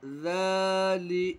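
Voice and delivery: ज़ाली with ज़ा held long; stretched ज़ा. Shown as a demonstration. Teacher stretching only ज़ा for pupils. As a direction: draw out ज़ा, keep ली short. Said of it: "सिर्फ ज़ाल को खींचकर पढ़ेंगे".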